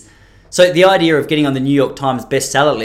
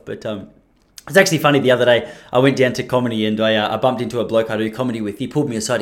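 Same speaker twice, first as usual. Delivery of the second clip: ordinary delivery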